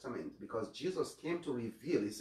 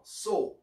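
a lone so, higher and louder; stressed so; 8 semitones higher, 5 dB louder than usual. Shown as a demonstration. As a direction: punch the single word So. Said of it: "the emphasis, so".

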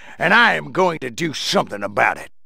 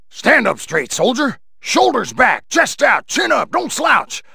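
higher, barked drill orders; drill shout; 5 semitones higher, slower than usual. Acted. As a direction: bark it like a drill sergeant at a recruit. Drill sergeant orders in head.

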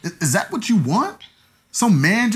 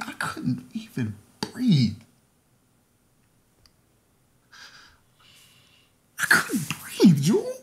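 slow, choked, breathy crying; barely getting the words out through tears; crying to ex about being left; tearful whisper; slower than usual. Acted. Confessing pain of breakup.

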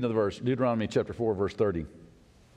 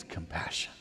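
whispered, much softer; 10 semitones lower, 11 dB quieter than usual. Real, not acted. Preacher whispering back to the objector. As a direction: breathe the word. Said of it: "whispered reply".

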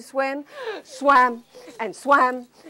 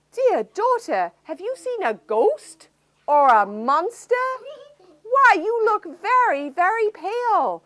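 raised worried fatherly voice; concerned king; 10 semitones higher, 7 dB louder than usual. Acted. King asks worried daughter.

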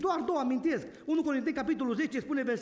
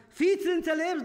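forceful reading; 6 semitones higher, 6 dB louder than usual. Real, not acted. Preacher reads the verse forcefully.

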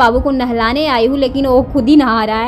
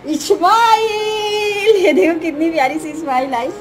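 put-on sing-song cooing to a baby, higher and lilting; baby talk; 4 semitones higher than usual. Aunt playing with her baby niece, sing-song baby talk. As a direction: coo and tease the little one like a doting aunt.

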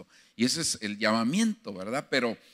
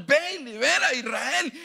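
God's command; 11 semitones higher than usual. Retold or imitated. God sends Gedeon.

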